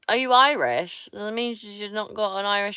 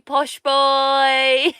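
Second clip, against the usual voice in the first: teasing mock posh; 7 semitones higher, 8 dB louder than usual.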